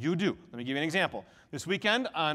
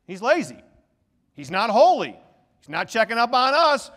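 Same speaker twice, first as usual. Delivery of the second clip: mocking complacent people; 2 semitones higher, 7 dB louder than usual. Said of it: "They look at me and say".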